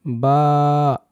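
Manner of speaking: বা with the vowel held long; drawn-out letter. Shown as a demonstration. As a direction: stretch বা out evenly. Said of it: "এইভাবে টান দিয়ে বলবেন". (বা টেনে লম্বা করে উচ্চারণ)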